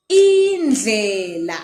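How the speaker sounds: Indlela slowly, with a lateral dl; slow teaching pronunciation; careful Ndebele word. Shown as a demonstration. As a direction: stretch Indlela for the learner. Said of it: "The next word I have for you is".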